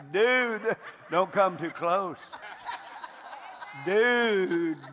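loud laughing warning, slowed down. Retold or imitated. Preacher warning the angry husband, laughing loudly. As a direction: warn him off through laughter. His own reply, laughing.

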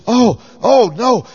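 high, slow, loud frightened cry; a sweet old lady suddenly scared; panicked old lady. Retold or imitated. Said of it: "now she really became panic stricken".

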